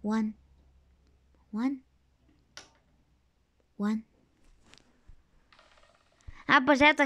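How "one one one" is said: lightly imitated low, soft voice; quiet low mimicry, word by word; imitating One. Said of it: "quiero imitarlo".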